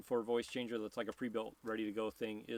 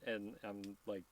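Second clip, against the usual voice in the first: usual speaking voice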